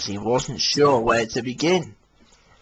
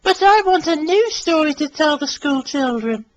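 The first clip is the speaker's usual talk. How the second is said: Mary Marvel voice. Acted. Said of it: "smiled Mary".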